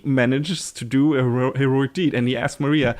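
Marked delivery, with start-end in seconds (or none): none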